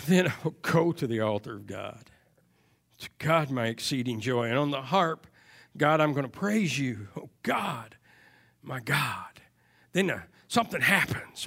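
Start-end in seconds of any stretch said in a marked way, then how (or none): 0.1-9.4 hushed, sorrowful reading of the psalm; quiet grieving king. Acted. Preacher voicing a grieving King David, hushed and sorrowful. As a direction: let a hurting king speak softly. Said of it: "a quiet, grieving, hurting King David".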